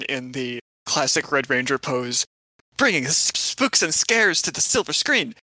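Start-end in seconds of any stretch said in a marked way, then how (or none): 2.8-5.3 heroic announcer shout. Acted. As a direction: proclaim it like a superhero intro. Heroic team roll call.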